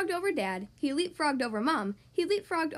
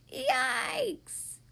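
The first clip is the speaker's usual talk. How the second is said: high, drawn-out comic yelp; alarmed yelp; 9 semitones higher than usual. A narrator's reading. Frog flying along with bowling ball, alarmed yelp. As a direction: stretch it high as if flung through the air.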